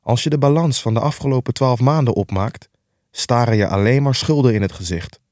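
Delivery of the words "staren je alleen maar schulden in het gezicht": usual speaking voice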